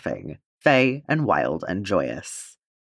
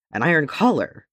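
higher, surprised outburst at the notched axe; exclaimed; 6 semitones higher than usual. A narrator's reading. Gimli finds his axe notched on armor, surprised exclamation. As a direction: blurt it out in startled dismay. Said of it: "Gimli exclaimed".

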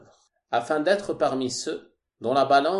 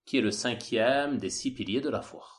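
ordinary delivery

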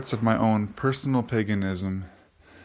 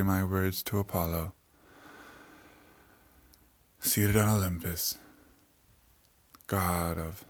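solemn prayer; 5 dB quieter than usual. Acted. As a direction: pray softly and reverently. Offers a prayer to gods.

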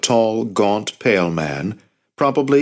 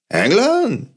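surprised; 14 semitones higher than usual. A narrator's reading. Maury incredulous.

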